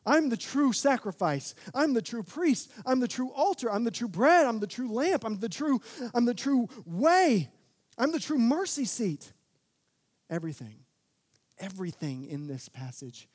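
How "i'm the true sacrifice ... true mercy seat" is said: high, loud, ringing declarations; preaching at full force. Retold or imitated. Jesus proclaiming himself the true temple, loud and ringing. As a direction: proclaim each line like a sermon climax. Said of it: "He was saying".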